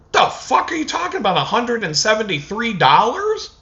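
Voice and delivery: high, fast disbelieving yelp at the cashier; high-pitched mock outrage; incredulous outburst. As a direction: act floored by the price, voice shooting up.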